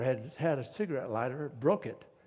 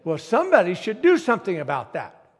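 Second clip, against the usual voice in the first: louder indignant retort; 4 semitones higher, 12 dB louder than usual. Retold or imitated. Waitress voiced in retold story.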